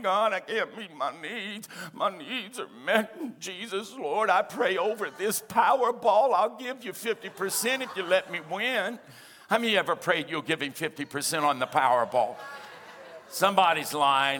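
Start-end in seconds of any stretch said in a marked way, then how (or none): 0.0-9.0 lightly voiced pleading believer; a hint of a desperate lottery prayer; mock prayer. Pastor mimicking Christians praying over the Powerball, mock pleading.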